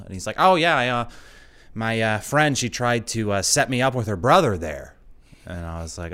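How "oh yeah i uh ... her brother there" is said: lightly voiced talkative driver, louder, higher at the start; a touch of the chatty driver, louder. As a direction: play the overfamiliar driver sharing too much. Voicing the driver's anecdote.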